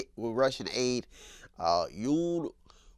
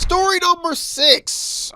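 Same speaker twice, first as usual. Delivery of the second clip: high, slow drawn-out segment title; announcer call; 6 dB louder, slower than usual. Acted. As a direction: stretch it like a show announcer.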